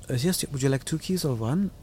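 mock German accent, politely offering keys; German hotel clerk. Imitating a German hotel clerk for a friend, lightly accented. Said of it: "You think you'd start talking that way?".

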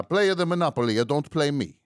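high, loud hammed-up Italian accent. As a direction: quote the islander's grand phrase in Italian style. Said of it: "this Italian accent".

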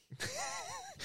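high breathy laugh. Real, not acted. Laughing at the client's mum joke. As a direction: let out a wheezy laugh.

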